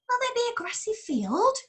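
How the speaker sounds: high, quick sheep voice; a sheep asking eagerly. Acted. Sheep asks.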